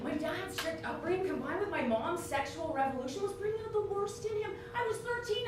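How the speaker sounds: ordinary delivery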